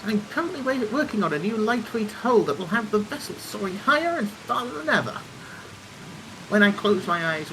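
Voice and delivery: lofty, enthusiastic airship engineer; eager engineer character. Acted. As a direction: play a proud inventor showing off designs. Voices engineer character in game.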